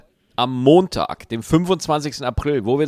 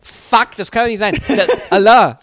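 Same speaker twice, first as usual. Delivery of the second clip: retold outburst; 6 semitones higher, 5 dB louder than usual. Retold shock at lost AirPods.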